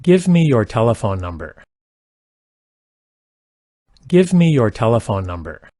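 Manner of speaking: ordinary talk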